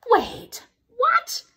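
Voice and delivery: startled high "what?"; surprised. Real, not acted. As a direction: caught off guard, rising. Reader startled by the book.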